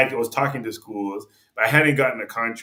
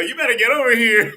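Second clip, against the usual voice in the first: high, loud, laughing through the invitation; laughing retold invite; 11 semitones higher, 7 dB louder than usual. Player laughing as he tells a friend to come over.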